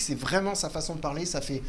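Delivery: ordinary delivery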